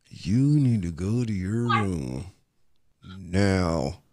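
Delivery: low, soft, slow order through still lips; ventriloquist dad. Retold or imitated. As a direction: imitate a stern dad, mouth barely moving. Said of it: "your mouth ain't even moving".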